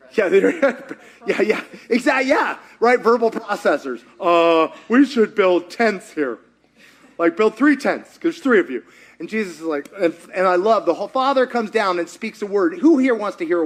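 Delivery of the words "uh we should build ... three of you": clueless Peter. Retold or imitated. Peter babbling on the mountain.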